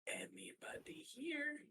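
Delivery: unvoiced whisper aside. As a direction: whisper it behind a hand. Conspiratorial aside.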